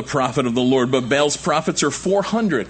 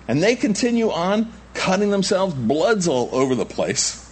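ordinary talk, nothing marked

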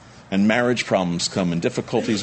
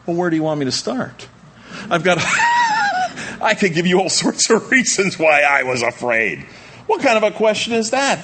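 higher, louder incredulous outburst with a laugh; exasperated retort; 11 semitones higher, 5 dB louder than usual. Acted. Preacher answering Jesus for the scared disciples, exasperated. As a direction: fire back, half laughing, unable to believe the question. Indignant reply to Jesus' question.

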